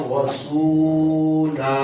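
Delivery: वसूला drawn out slowly in recitation tone; Quranic recitation. Shown as a demonstration. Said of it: "ये पढ़ा जाएगा और".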